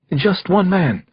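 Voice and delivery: frightened witness, a touch of whimper. A narrator's reading. Shaken young woman answering the detective, lightly whimpering. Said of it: "she whimpered to me".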